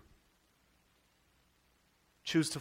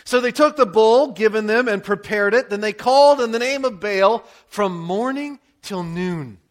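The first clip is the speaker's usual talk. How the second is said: ordinary talk, nothing marked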